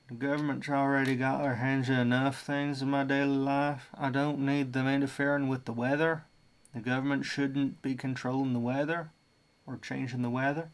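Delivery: Southern accent, voicing someone's complaint; generic Southern accent. Someone objecting to government weather control, in a generic Southern accent. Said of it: "like, generic South".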